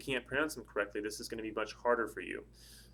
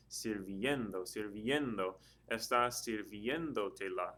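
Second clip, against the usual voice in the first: slow, careful "sirviendo", stressed on "vien"; 3 dB quieter, slower than usual. Shown as a demonstration. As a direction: draw out the E in "sirviendo". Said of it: "think about how the word is pronounced".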